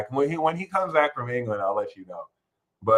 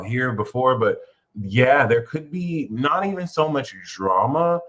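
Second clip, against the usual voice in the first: usual speaking voice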